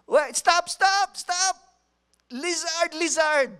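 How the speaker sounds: high, loud frantic yell to stop him; desperate shout. Retold or imitated. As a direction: shout after a runner who is getting away. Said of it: "sa desperation nila, sabi nila".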